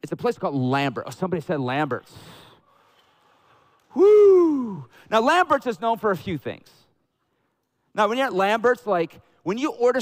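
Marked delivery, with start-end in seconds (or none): 4.0-4.9 loud, high, drawn-out "woo"; excited whoop. Real, not acted. Preacher cheering the restaurant's name, excited whoop.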